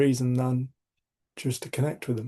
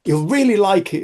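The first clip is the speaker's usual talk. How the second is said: put-on higher, louder eager kid; boy's voice; 8 semitones higher, 7 dB louder than usual. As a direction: sell it like an excited boy. Voicing the boy's encouragement.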